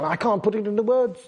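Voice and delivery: groping for words as Peter, lightly voiced; Peter lost for words. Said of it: "He's trying his hardest".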